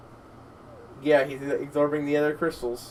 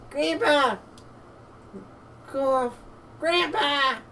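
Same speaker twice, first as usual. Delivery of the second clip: put-on high little-girl character voice; distressed granddaughter; 9 semitones higher, 3 dB quieter, slower than usual. Child calling to fallen grandpa, high distressed cry. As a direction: call out high to a fallen loved one.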